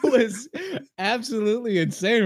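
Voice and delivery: usual speaking voice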